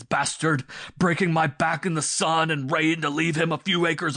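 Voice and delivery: loud, high raging shouts; husband raging and threatening murder; furious outburst. A narrator's reading. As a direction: let the wrath explode. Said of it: "burst forth with renewed violence".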